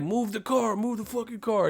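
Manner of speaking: half-voiced angry heckle; yelling drivers. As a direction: pester him as an impatient driver. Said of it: "They're like".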